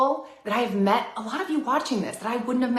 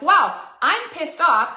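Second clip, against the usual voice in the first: pissed off; 7 semitones higher, 6 dB louder, much slower than usual. Retold or imitated. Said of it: "I'm like".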